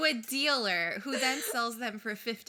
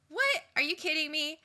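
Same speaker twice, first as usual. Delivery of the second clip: excited quote; 6 semitones higher, 6 dB louder than usual. Retold or imitated. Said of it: "she goes like".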